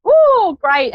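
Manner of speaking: high ooh of relief; light up when she answers; delighted surprise. Real, not acted. Caller finally gets through.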